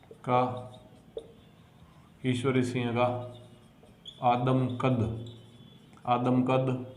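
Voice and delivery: soft, slow, word by word for notes; slow dictation. Shown as a demonstration. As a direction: dictate each word quietly with long pauses. Teacher dictating notes slowly.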